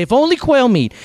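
high-pitched imitation of grumbling; mock complaining. Preacher imitating Israel complaining to Moses, high mock grumble. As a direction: imitate a crowd's whiny complaint. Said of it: "they're crying and grumbling and complaining".

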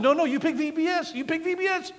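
high mock-playful voice dismissing her; teasing brush-off. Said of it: "I said".

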